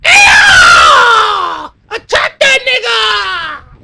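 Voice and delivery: very high, drawn-out shout; acted battle cry. Retelling Eagly's order from the rock, high drawn-out shout. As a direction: scream a long, piercing call to attack. Acts out Eagly's call to attack.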